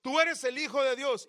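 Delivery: loud, high cry of demons. Acted. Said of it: "están diciendo". (a voces, fuerte y agudo)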